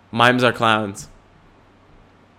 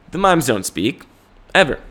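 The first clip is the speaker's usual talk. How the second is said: ordinary delivery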